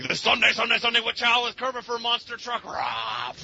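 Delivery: booming monster truck rally announcer. Shown as a demonstration. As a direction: hype it up like a Sunday Sunday Sunday ad. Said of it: "I have to do my really bad, like, monster truck rally".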